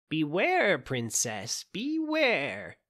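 high, urgent witch warning. A narrator's reading. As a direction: warn her sharply, rising. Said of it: "in a warning voice".

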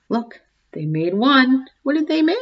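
plain reading voice

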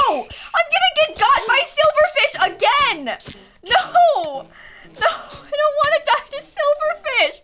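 high, loud laughing yell; laughing panic. Real, not acted. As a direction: shriek it half laughing. Silverfish attack, laughing panic.

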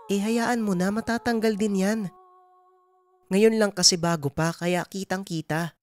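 high motherly voice soothing away a worry; Nanay Dolor, reassuring mother. Acted. Mother tells son the marks will fade, reassuring. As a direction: reassure your child, then hurry him along home.

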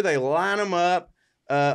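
normal talking voice

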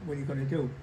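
ordinary talk, nothing marked

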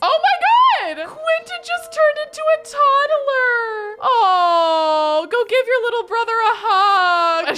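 high, loud, squealing half-laughing shock; high squeal that cracks into laughing cries. Real, not acted. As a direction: shriek with laughing disbelief. Shocked by sudden toddler transformation.

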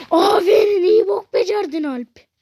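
high, loud mock praise; fake-congratulate him with a sneer; sarcastic praise. Sarcastically praising teammate Alp for messing up.

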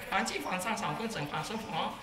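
mock Chinese gibberish chatter; tiruan mock Chinese. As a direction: imitate Chinese speech with made-up syllables. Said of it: "orang Cina bicara dengan orang Cina". (menirukan bahasa Cina asal-asalan)

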